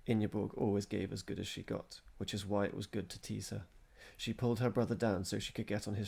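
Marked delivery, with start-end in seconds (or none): none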